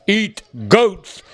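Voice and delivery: dull, blunt monster voice. Acted. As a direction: say it like a simple hungry monster. Monster states its aim.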